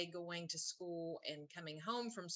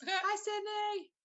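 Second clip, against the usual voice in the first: high, drawn-out slow hello; excited greeting; 6 dB louder, much slower than usual. Real, not acted. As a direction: stretch the greeting out, sing-song high. Greeting stepdaughter again.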